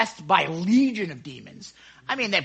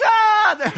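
high, loud "aah" of panic; sudden acted fright scream; terrified yell; 9 dB louder than usual. Preacher voices disciples thinking they see a ghost, terrified yell. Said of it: "that's what they're thinking".

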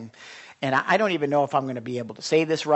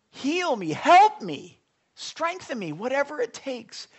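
imploring plea; 9 semitones higher than usual. Retold or imitated. Imploring prayer acted.

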